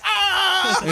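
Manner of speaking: high squealing mock panic; shrill cartoonish alarm; mock panicked yell. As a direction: squeal like a terrified movie extra. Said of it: "They're like".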